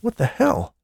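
sharp high startled exclamation; a sudden what-the-hell cry; exclaimed. A narrator's reading. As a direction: blurt it out in shock. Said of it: "he exclaimed".

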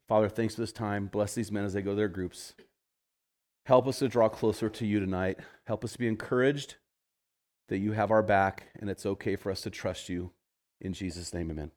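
soft, fading closing prayer. Acted. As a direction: pray quietly over the men. Closing prayer over the men.

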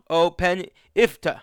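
O Pen Ifta spoken slowly with every letter clear; slow, careful O Pen Ifta, nothing swallowed; crisp enunciation. Shown as a demonstration. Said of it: "enunciate and say crisply and clearly".

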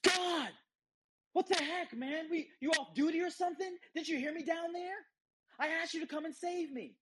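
high, indignant protest at God; mock outrage, pitched high, at being left to drown; livid complaint. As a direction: surfer in heaven scolding God, high and indignant. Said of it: "and he's livid".

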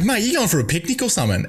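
high, fast mocking jab, laughing at him; teasing jibe. Athlete teasing a rival about his massive esky, high mocking jibe. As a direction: poke fun at his picnic gear.

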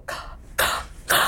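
"k" made on a sharp intake of breath; inhaled k sound. Shown as a demonstration. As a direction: say "k" while breathing in, lifting the soft palate. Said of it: "make the sound k on an intake of breath".

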